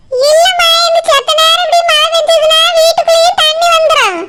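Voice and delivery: ordinary delivery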